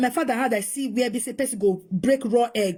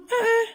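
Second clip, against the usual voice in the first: pained cry; 11 semitones higher than usual. Real, not acted. Hurt by cruel mockery.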